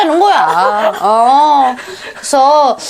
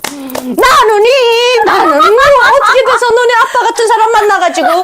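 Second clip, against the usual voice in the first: mother's rant; 8 semitones higher than usual. Retold or imitated. Mother venting at her daughter about her father, rising to a shout. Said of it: "그릇에다가 다 화풀이를 하지".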